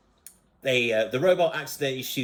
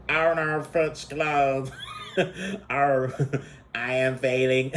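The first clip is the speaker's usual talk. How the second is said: slow robot speech, breaking into laughs; old computer voice; slower than usual. Retold or imitated. Reviewer imitating the funny robot, old computer voice. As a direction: do a dated talking computer. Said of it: "he was speaking like a Windows ninety-eight version".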